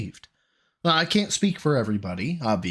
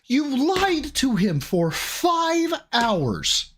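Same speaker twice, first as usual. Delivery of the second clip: high, slow, drawn-out accusation; high-pitched indignant rebuke; 9 semitones higher, much slower than usual. Real, not acted. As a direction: stretch every word in outrage. Angry retort to the clip.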